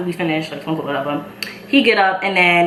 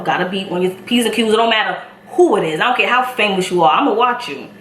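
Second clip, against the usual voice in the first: ordinary talk, nothing marked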